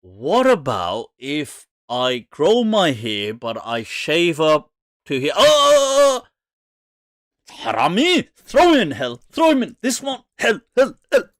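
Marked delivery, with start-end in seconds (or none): 5.4-11.3 high, loud mock outrage, in short bursts; hammed-up cry of condemnation; mock outraged God. God catching someone shaving to here, mock outraged.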